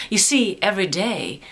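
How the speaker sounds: ordinary delivery, nothing marked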